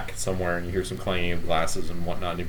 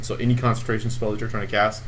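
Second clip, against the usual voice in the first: usual speaking voice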